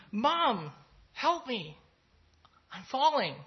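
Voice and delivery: put-on child's cry for Mom in an American accent, voice up; American accent, plea for help. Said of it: "that was my American accent".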